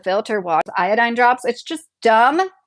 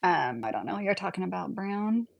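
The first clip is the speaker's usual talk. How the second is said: breathy whisper, much softer; whispered aside; 3 semitones lower, 12 dB quieter than usual. Real, not acted. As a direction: drop to a whisper for a quick aside. Aside answering a chat comment.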